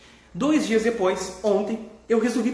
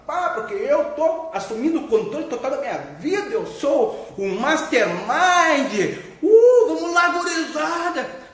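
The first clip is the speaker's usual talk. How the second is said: mock hype coach; 4 semitones higher, slower than usual. Mocking self-help gurus to his followers, hammed-up hype coach. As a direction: parody a pumped-up coach rallying the crowd.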